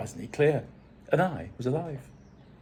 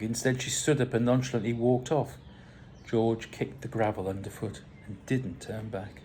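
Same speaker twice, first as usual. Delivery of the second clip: ordinary delivery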